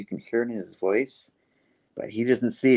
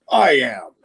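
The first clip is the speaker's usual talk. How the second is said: loud, raised cry of defiance; acted outburst; 4 semitones higher, 8 dB louder than usual. Norman answering the goblin in his head, loud outburst. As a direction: burst out as the character, louder and higher.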